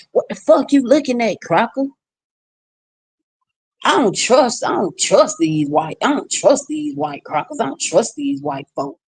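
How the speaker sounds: mock her sneering at a passing stranger; imitating mama's rude jeer. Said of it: "and she was like".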